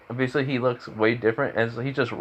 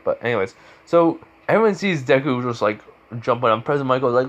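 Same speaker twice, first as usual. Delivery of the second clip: ordinary delivery, nothing marked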